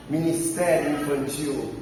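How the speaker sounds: normal talking voice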